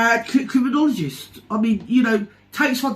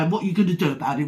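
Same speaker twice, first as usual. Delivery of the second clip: normal talking voice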